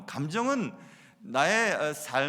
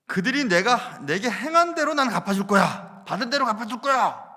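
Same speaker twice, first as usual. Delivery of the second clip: louder, higher vow of revenge; louder Samson vowing to repay in kind; defiant vow; 5 semitones higher, 8 dB louder than usual. Retold or imitated. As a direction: declare the payback like a battle cry. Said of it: "그게 삶의 모토였습니다".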